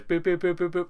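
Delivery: high, bouncy boop boop; electronic boops. Retold or imitated. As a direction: beep like a toy. Imitating the toy's sound.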